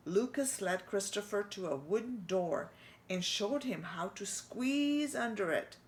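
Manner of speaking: ordinary delivery, nothing marked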